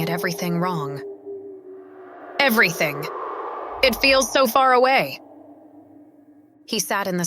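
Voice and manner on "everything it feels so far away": rising to a pained high shout; despairing outburst, louder and higher; anguished cry. Acted. Despairing cry of lost hero.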